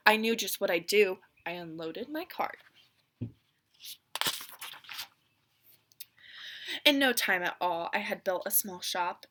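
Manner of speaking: put-on lively read-aloud narration for children; storybook reading. A narrator's reading. Teacher reading The Lorax to her class, lively storybook voice. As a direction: read it like a picture book to a class.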